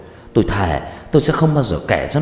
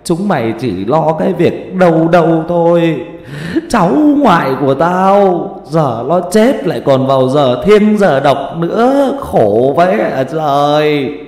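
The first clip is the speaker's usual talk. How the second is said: much higher, loud, slow keening wail; an old grandmother howling over her dead grandchild; wailing lament; 8 semitones higher, 6 dB louder, slower than usual. Acted. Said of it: "Bà Yên đang dãy đành đạch ngồi khóc".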